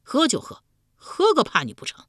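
high-pitched, defiant comeback. A narrator's reading. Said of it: "高声回道".

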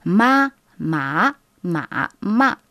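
ma said in first, second, third and fourth tone; four Mandarin tones. Shown as a demonstration. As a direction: run through the four tones on ma. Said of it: "une technique ... pour maîtriser les quatre tons".

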